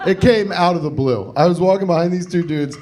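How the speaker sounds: usual speaking voice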